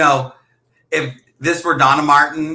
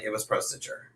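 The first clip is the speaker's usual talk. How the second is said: whispered aside; imagined remark; 6 semitones lower, 15 dB quieter than usual. Retold or imitated. Imagining what her friend would say, a soft whisper. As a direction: breathe it out as a guess. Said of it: "you were gonna be like".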